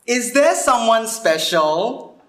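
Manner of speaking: high, drawn-out nosy question; coy prying. Acted. Old schoolfriend prying into her love life, coy. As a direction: stretch it out, fishing for gossip. Friend prying at reunion, acted.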